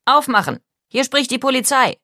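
loud, high, commanding call; police command. A narrator's reading. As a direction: call out sharply as police demanding entry. Police demanding entry at the door. (laut und befehlend gerufen)

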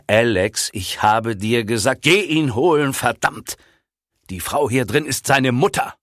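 heated, high and loud rebuke; angry outburst. A narrator's reading. Bill orders Alex to fetch Jamie, angry. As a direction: snap the order, pitch leaping up. Character swears in phone quarrel.

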